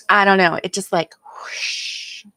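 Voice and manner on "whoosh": soft rushing whoosh; imitated whoosh. Shown as a demonstration. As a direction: blow out a hushed, airy whoosh. Imitates the effect of coffee.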